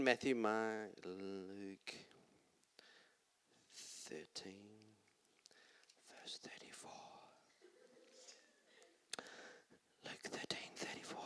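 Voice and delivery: very soft muttering while flipping pages; under the breath, searching the Bible; muttered to self. Real, not acted. Preacher muttering to himself while finding the verse, hushed.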